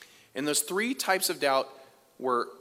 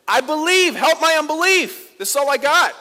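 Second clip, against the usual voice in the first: much higher and louder desperate cry; father crying out to Jesus for his son, anguished shout; crying out in tears; 12 semitones higher, 12 dB louder than usual. Acted. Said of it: "cries out in tears".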